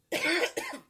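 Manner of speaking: sharp chesty cough from a cold; loud cough. Real, not acted. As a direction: cough hard into the mic. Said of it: "I have a cold".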